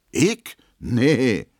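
mock-offended protest; indignant. A narrator's reading. Emma denies dancing with the bomb thrower, indignant. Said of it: "sagt Emma empört".

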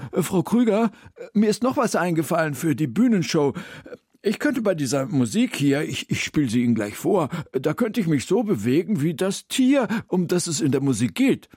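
Herr Mommsen als Figur. A narrator's reading. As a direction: burst in with a halting, eager idea. (übereifrige, erhöhte Figurenstimme)

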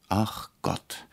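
a soft, weary groan. A narrator's reading. Director groaning at the ignorant boss, softly weary. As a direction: sigh it out, exasperated. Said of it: "stöhnte der Regisseur".

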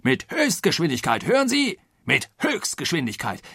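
high, insistent outburst, peaking mid-way; riled colonel. A narrator's reading. Colonel riled by Passepartout's caution, angry insistence. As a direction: hammer it home angrily. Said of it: "in Harnisch brachte".

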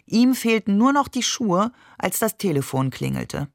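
plain reading voice

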